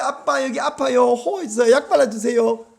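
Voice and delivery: put-on coaxing child. Beaten son to his angry father, imitated childish coaxing. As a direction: wheedle like a child wanting dad's comfort.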